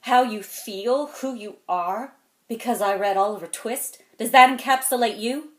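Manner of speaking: loud, emphatic rhetorical questions; indignant challenge. Acted. Man challenging an orphan over Oliver Twist, loudly indignant. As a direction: throw the questions at him forcefully.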